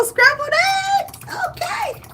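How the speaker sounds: cheering that the eggs didn't scramble; excited squeal. Acted. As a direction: squeal it in triumph. Relief after tempering eggs.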